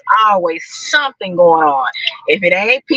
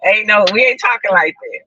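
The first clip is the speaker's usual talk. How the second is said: ordinary talk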